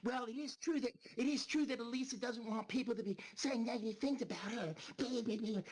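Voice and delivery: sneering impression of a rival streamer; mocking Leaf. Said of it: "and then Leaf goes".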